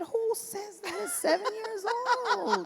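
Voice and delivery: high, laughing disbelief; amazed laughing. Real, not acted. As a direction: marvel at it with a laugh. Amazed at her daughter.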